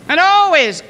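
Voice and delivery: normal talking voice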